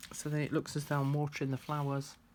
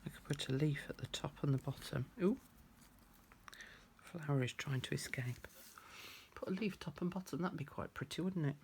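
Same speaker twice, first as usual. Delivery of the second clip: concentrating whisper; 7 dB quieter than usual. Real, not acted. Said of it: "Sorry, I'm concentrating".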